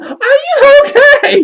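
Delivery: high, loud, drawn-out mock shriek; retold alarmed yell. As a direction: shriek it like a panicked friend, laughing. Acting out friend's alarmed question.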